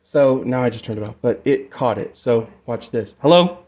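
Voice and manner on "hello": loud, high hello into the mic; mic test call. Shown as a demonstration. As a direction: project it to test the echo. Calling into mic to demo echo.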